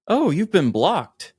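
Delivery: ordinary talk, nothing marked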